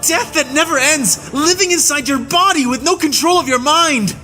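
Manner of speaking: very high furious scream, building to the end; screaming in rage. A narrator's reading. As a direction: scream the horrors at her. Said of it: "Farnes screamed".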